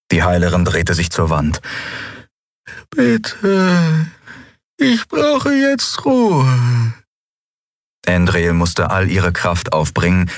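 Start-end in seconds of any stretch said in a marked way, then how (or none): 2.9-6.9 high, very slow and tired; weary old healer. Acted. Xea turning to the wall, wearily asking for rest. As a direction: ask for rest, worn out.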